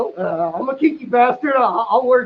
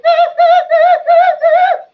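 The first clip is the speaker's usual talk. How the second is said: high cackle; 8 dB louder than usual. Real, not acted. Laughing at own story.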